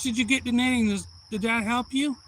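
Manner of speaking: loud, high granny question; granny teacher. Retold or imitated. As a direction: imitate a granny checking on her pupil, loudly. Granny checks on her pupil.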